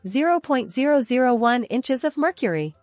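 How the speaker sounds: usual speaking voice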